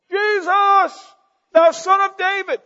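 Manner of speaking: very high, loud desperate call; a beggar's frantic high cry. Acted. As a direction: strain to be heard over the crowd. Beggar keeps crying out.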